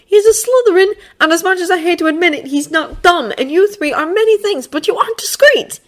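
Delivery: put-on high, loud haughty boy voice; Draco voice. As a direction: promise it with a sneer.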